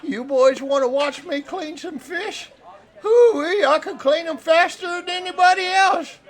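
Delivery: excited fish-camp hand. Acted. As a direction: brag loudly in a high, goofy voice. Game master plays Cletus.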